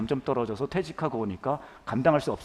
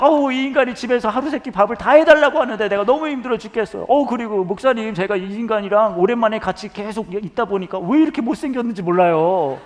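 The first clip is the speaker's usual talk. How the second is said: much higher and louder hammed-up wife, laughing in places; nagging wife rant; 9 semitones higher, 10 dB louder than usual. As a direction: gush complaints about the husband like a fed-up ajumma. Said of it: "뭘 뭐라고 하시냐면".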